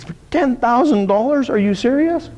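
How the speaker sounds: high, incredulous mock outrage at the bill; gasping disbelief. Manager reading the expert's invoice, mock gasp of disbelief. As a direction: sound shocked at the price. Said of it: "The guy's gasping".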